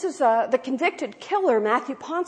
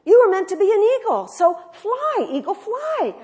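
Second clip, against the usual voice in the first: high, loud urging call; urging the eaglet; 11 semitones higher, 7 dB louder than usual. Retold or imitated. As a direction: cheer the little bird on to fly. Friend urging eaglet to fly.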